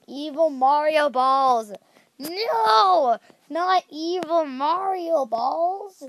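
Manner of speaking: slow, drawn-out hammed-up horror; mock dread. Gamer announcing the evil Mario balls disaster, mock horror. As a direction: announce the doom like a movie trailer.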